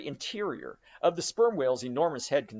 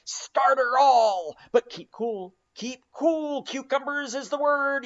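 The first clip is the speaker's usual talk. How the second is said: high, loud cheering on of the rowers; urging shout; 7 semitones higher, 5 dB louder, slower than usual. A narrator's reading. Stubb spurring Tashtego and the crew, high ringing shouts. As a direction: drive the crew on at full voice.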